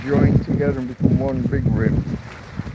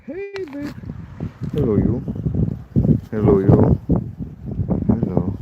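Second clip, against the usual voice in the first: cooing to a pet; 6 semitones lower than usual. Real, not acted. Cooing to his pet Tina.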